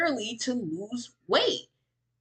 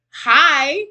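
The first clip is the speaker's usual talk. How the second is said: excited hi; 4 semitones higher, 10 dB louder than usual. Real, not acted. Greeting celebrity in chat.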